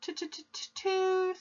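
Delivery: stuttered breathless gasp; terrified boy stammering the answer, gasping. Acted. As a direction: choke it out in fear. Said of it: "gasped Rupert".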